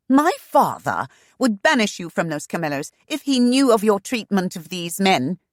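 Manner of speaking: high, loud sharp snap; snapping. A narrator's reading. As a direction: snap a threat. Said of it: "Shanna snapped".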